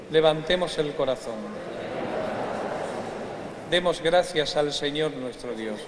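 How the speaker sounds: ordinary talk, nothing marked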